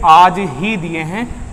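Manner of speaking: slow, drawn-out आज ही; emphatic, unhurried आज ही; stress on आज ही. Shown as a demonstration. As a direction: spell out आज ही as the answer. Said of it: "focus करके ये बात कही है".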